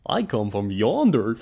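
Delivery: slow, loud cartoon Goofy drawl; dopey cartoon voice. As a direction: ring wraith done as Goofy. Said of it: "have the same accent as Goofy".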